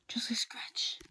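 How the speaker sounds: breathy downplaying whisper; whispered denial. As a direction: hide the bite quietly. Hiding a bite.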